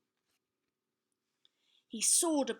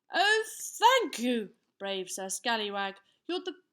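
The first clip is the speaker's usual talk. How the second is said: rejoicing; 7 dB louder than usual. A narrator's reading. King and queen thank the brave knight, rejoicing. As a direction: gush with royal relief. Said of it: "rejoiced the king and queen".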